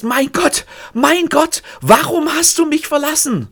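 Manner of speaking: very high, loud anguished cry; an inner scream made loud, pitched very high. Acted. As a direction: let it burst out of her. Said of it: "Laut, lauter, lauter als je irgendetwas".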